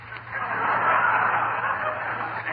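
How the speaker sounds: high, breathy cackle at his own prank; gleeful laugh. Real, not acted. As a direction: burst into a high giggle of mischief. Laughs at his mischief on phone.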